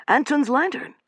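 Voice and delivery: high, excited cry of relief; triumphant exclamation. A narrator's reading. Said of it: "exclaimed Joe triumphantly".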